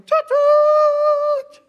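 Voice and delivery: very loud, high imitated train horn; short toot, then a long piercing one; powerful locomotive whistle. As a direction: blast it with all the power of a locomotive. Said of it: "this powerful locomotive with all this power".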